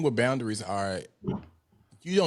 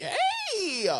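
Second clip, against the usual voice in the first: very high, drawn-out squealed hello; hammed-up overjoyed phone greeting; exaggerated excited greeting. Friend mocking how excited he wants her to sound, over-the-top excited. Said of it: "what I supposed to be like".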